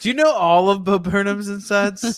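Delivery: high, loud mimicry rising on "insides"; exaggerated mock inflection. Teasing a friend over the misheard insides, exaggerated mock inflection. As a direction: overdo the friend's question, pushing up into "insides". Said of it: "You said that at an inflection".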